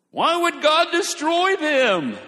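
high, loud, drawn-out complaint; mock outraged protest. Imagined objector protesting God's judgement, in a mock outraged wail. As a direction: wail the question in mock indignation.

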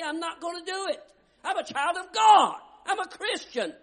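high, loud, defiant preaching cry; proud declaration. Acted. Preacher giving the congregation its answer, high and defiant. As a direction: declare it like a champion. Model reply for believers.

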